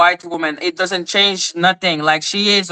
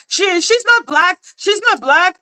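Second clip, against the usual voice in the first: much higher mocking voice; Evangelist Val; 12 semitones higher than usual. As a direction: mimic an accuser in a high-pitched whine. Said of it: "Evangelist Val was like".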